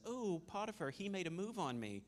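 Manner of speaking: high-pitched mock-wounded complaint; offended wife. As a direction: tattle in a high, put-on offended voice. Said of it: "Potiphar's wife gets all offended and goes".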